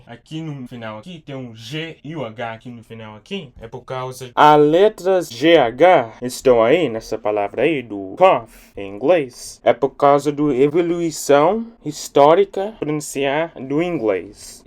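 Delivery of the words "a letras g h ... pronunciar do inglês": loud. Real, not acted. Loud lesson.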